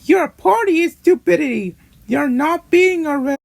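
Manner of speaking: high-pitched, indignant outburst; angry marshmallow. Acted. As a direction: act out an angry character in a very high voice. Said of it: "Marshmallow getting angry said".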